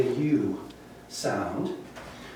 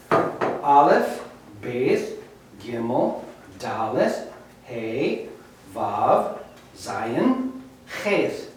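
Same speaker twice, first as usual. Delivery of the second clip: lower, softer, each letter spaced out; softer alphabet recitation; 3 semitones lower, 4 dB quieter, much slower than usual. Shown as a demonstration. Teacher reading the alphabet a second time, softer and lower. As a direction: go through the letters once more, gently.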